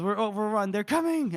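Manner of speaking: high, urgent imitated alarm; mock panicked messenger. Co-host retelling messenger fleeing the attack, mock panic. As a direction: cry the warning in pretend panic. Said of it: "messenger that's panicked".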